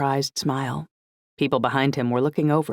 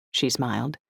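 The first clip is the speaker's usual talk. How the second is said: ordinary delivery, nothing marked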